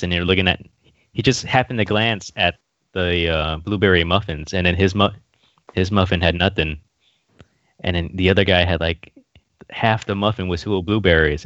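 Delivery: usual speaking voice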